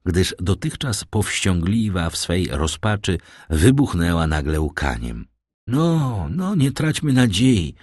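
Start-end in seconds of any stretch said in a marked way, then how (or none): 5.7-7.7 higher-pitched, dazed reassurance; absent, dazed. A narrator's reading. Old father clutching at hope, dazed. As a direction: say it as if not quite present. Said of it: "ozwał się niezbyt przytomnie".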